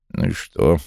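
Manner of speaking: mild, understated grumble; grumbled. A narrator's reading. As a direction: grumble it dismissively. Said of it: "буркнул Вулф". (негромкое ворчание)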